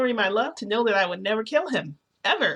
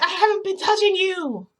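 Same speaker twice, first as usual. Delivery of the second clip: high, loud wail of protest; exasperated, high-pitched yell; indignant outburst; 12 semitones higher, 6 dB louder than usual. Retold or imitated. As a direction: cry out the denial at a high pitch. Acted outburst denying the accusation.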